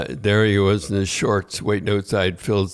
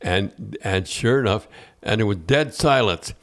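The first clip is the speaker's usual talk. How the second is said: ordinary delivery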